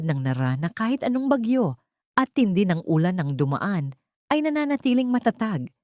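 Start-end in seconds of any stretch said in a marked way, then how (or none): none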